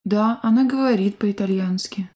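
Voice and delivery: usual speaking voice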